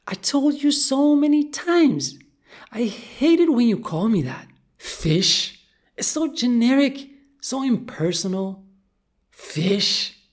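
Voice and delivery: high, loud indignant fish voice; offended goldfish. Acted. Goldie objecting to being called fish, offended. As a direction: protest loudly as an insulted little fish. Goldie argues back at Kat.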